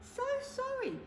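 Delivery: very high, polite squeak; little mouse voice. Acted. The mouse apologises to the weeping Bear. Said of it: "said the mouse".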